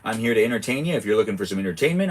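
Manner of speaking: normal talking voice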